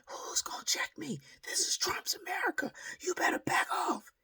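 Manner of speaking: high shrill-pitched imitation of a ranting woman; mock yelling woman. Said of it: "I'm still hearing this yelling".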